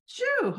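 sudden high sneeze; real sneeze, caught off guard; sneezing. Real, not acted. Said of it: "I just sneezed in the middle of the podcast".

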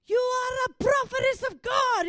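put-on very high, booming French-accented shout. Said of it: "very loud, and, you know, French".

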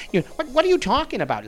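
high, fast, flustered burst in mock disbelief; mock exasperation. Arguing with an imagined listener, mock exasperation. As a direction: cut in, incredulous, stumbling over the start. Acted confrontation with imagined visitor.

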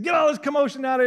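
fast, loud, high order; commanding shout. Retold or imitated. Jesus clearing mourners from the house, shouting fast. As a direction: bark the order to clear the room. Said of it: "He said".

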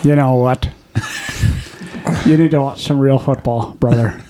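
put-on Irish brogue; measured mock-serious Irish accent. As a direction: deliver a teasing challenge in an Irish accent. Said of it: "bring it in his Irish accent".

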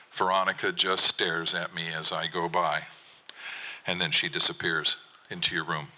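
ordinary delivery

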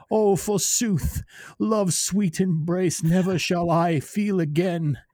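high, slow, overwrought tortured poet; hammy stage actor. Pretend. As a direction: ham it up like a lovesick Shakespearean. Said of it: "What are you, some fucking tortured poet?".

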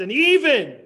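EVEN soaring high and loud, then dropping; stress on EVEN. Real, not acted. Preacher building up Daniel's trials, stress on EVEN. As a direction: throw all the weight onto "even". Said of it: "I like the emphasis on the word even".

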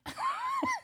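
high shout of laughter; sudden squealing laugh; shrieking laugh. Real, not acted. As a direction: burst into a shrill laugh. Burst of laughter.

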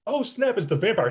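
high-pitched gleeful discovery, imitated; delighted realization. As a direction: act out a giddy sudden realization. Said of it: "when he realizes".

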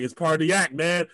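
urgent calming. Retold or imitated. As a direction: settle the big guy quickly. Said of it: "It was like".

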